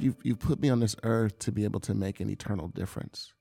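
ordinary talk, nothing marked

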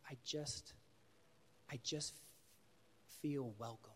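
halting, soft, with long hesitant pauses; shy. Retold or imitated. Shy boy answering an excited counselor, hesitant. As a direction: feel your way shyly to the words. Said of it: "he's really shy, this kid".

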